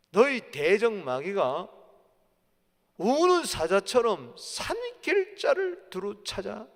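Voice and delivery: loud, slow scripture proclamation; forceful preaching. Acted. Preacher warns congregation of prowling devil, forceful preaching. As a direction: stretch out the warning words with force.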